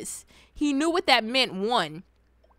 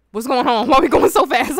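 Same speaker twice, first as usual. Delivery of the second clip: high, loud panicked questions; mock alarm; 6 semitones higher, 14 dB louder than usual. Child to speeding aunt, mock panicked questioning. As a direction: cry out in hammed-up alarm.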